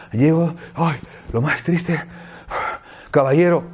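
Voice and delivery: breathless, gasping reading of lo más triste, caballero; panting. Shown as a demonstration. Teacher demoing a mood for students, breathless and panting. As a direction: say it as if you just arrived out of breath.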